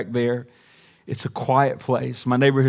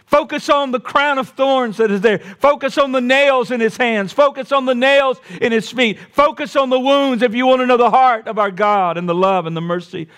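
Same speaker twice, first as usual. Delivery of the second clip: high, ringing repeated preacher cry; full-force preaching; 9 semitones higher than usual. Acted. Preacher driving home the wounds to his congregation, full-force preaching. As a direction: hammer each "focus" like a call from the pulpit.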